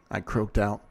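ordinary delivery, nothing marked

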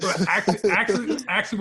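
ordinary talk

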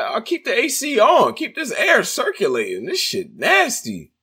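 high-pitched mock complaint; lightly voiced disgust. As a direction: squeak it out disgusted. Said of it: "I'm like".

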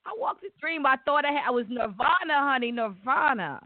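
high-pitched, swept-away delight; blissed-out and high; elated. Real, not acted. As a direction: gush it high, reliving pure joy. Reliving the best night ever.

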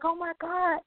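high-pitched retold astonishment; super shocked. Reliving the moment the lady offered to pay, super shocked. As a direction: relive your disbelief, pitched well up. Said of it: "I'm, like, super shocked".

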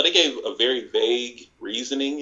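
ordinary talk

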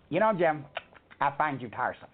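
a cold brush-off from the boss's table; imitating Kevin Dunn. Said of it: "And Kevin Dunn goes".

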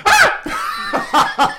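sudden high startled yelp; retold yelp. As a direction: let out a high, theatrical startled shriek. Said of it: "when she yelled".

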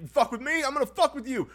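scaled-down high yelling; same screaming guy. Retold or imitated. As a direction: imitate a screamer passing by. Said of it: "The same guy".

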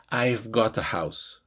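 slow, low "I've got a house", got joined to a; linked words. Shown as a demonstration. Teacher shows how words join, "got a" linked in "I've got a house". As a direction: run "got a" together, slowly and softly. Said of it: "Noten cómo se unen las palabras".

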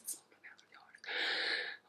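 very soft, voiceless whisper; whispered confession. Pretend. Host admitting to herself it runs long, whispered. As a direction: whisper it as a guilty aside.

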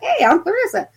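high, chirpy hello; mock cheery greeting. Investigator greeting a ghost unfazed, high mock cheery hello. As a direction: pitch way up for a playful introduction.

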